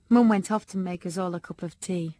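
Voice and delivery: usual speaking voice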